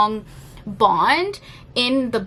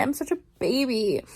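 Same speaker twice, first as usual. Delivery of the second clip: usual speaking voice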